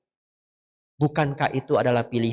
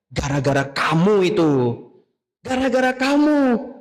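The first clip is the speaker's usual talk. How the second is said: rising accusing complaint, higher on the repeat; wife blaming husband; 5 semitones higher than usual. Acted. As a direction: blame the husband, getting sharper. Istri menyalahkan suami. (istri menyalahkan suaminya dengan kesal)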